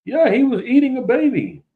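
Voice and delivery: high mock voice of a gullible believer. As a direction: ham up a credulous fool, pitched high. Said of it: "man, they gonna say".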